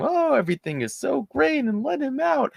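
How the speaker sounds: mocking gushing supporter. Host mocking supporters with a high gushing voice. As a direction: parody the starry-eyed supporters. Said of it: "the whole flying turtles and butterflies".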